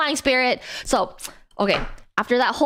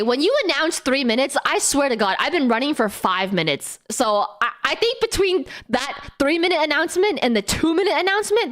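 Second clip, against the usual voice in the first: ordinary talk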